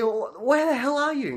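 high, drawn-out mock-irate question; exasperated demand. Husband demanding his lost wife's whereabouts, exasperated. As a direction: demand it slowly, voice climbing high. Acted phone quarrel with wife.

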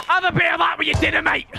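high, quick gloating yell; gloating shout. Real, not acted. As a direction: shout it fast at the beaten opponent. Celebrating a goal in game.

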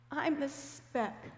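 high, slow, mildly tearful quote; retold tearful. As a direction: draw it out high, as if lightly choked up. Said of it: "she started crying".

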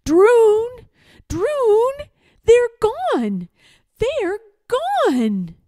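high, loud wail of loss; mournful shouts. A narrator's reading. King finding the stilt closet empty, mournful shouting. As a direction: cry out in despair for help. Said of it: "the king's mournful shouts".

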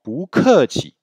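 "bu keqi" drawn out, each syllable apart; slow "bu keqi" right down for learners; said slowly. Shown as a demonstration. Teacher says "bu keqi" slowly for students. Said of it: "si on le dit lentement".

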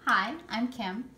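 ordinary talk

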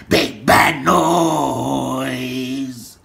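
very slow, last word stretched long; drawn-out name-drop. Real, not acted. Man calling out big bad noise, hugely drawn out. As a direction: drag "noise" right out. Same words repeated, drawn out.

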